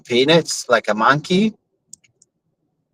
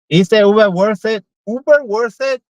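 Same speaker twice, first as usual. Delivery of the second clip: mock high taunt; 8 semitones higher, 3 dB louder than usual.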